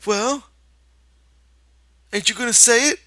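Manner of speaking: put-on high eager childlike prompt; eager Lenny. Lenny urges George to speak, childlike eager. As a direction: coax him on.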